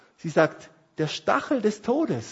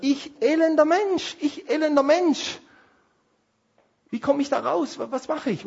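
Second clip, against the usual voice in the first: desperate cry; 8 semitones higher, 3 dB louder than usual. Acted. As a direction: cry out as a wretched man at his end. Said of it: "Er schreit dann und sagt". (verzweifelter Aufschrei, hoch und langsam)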